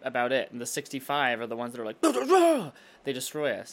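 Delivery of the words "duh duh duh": high loud mock blaring; mock alarm. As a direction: blare it like a silly alarm. Mocking bad snow days.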